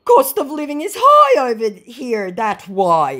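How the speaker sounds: whiny scammer plea; mock scammer voice. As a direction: scammer excusing himself, mocked. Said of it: "he said".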